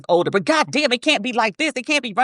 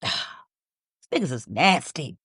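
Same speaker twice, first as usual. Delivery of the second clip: disgusted; 5 semitones lower, 5 dB quieter, much slower than usual. Real, not acted. Disgust after clip.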